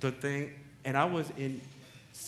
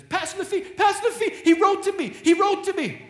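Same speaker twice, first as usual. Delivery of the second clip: high, loud joyful cry; excited girl; 10 dB louder than usual. Retold or imitated. Paula calls out to the pastor that her father wrote. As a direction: burst out with the good news. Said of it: "Paula gets up and she says".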